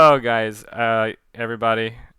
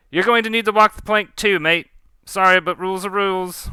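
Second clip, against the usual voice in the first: higher, louder pirate captain's verdict; blunt sentencing; 8 semitones higher, 4 dB louder than usual. Acted. Captain sending Graves to walk the plank, blunt. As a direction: break the bad news loudly, mock apologetic.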